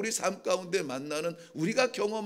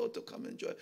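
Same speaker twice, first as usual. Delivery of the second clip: whispered plea; 9 dB quieter, much faster than usual. Retold or imitated. David repeats his plea to God, whispering. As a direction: repeat 좋아요 in a voiceless whisper.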